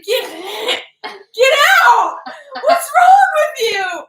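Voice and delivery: high, loud hammed-up screech; mock gagging yell. Acting out disgusted brother at front door, mock yelling. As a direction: shriek it while half gagging and laughing. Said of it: "he's laughing and gagging".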